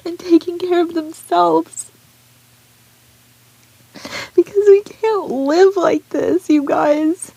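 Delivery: high, strained, tearful plea; pleading through tears; crying plea. Real, not acted. Vlogger to her viewers, pleading while crying. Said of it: "will not apologize for crying".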